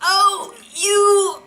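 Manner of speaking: imitating a peppy girl's blank greeting; mock dismissive girl. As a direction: toss it off like a bored girl. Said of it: "She's like".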